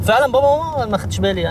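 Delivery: high, put-on astonishment; sarcastic mock surprise. Man mocking the girl's potato parents with fake surprise. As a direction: pretend to believe her.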